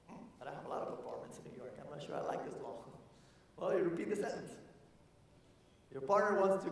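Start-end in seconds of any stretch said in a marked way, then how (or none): 0.1-2.8 low, soft grumbling landlord voice; mock grumbling landlord. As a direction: mutter it like a doubtful landlord. Doubtful landlord voice, mocked.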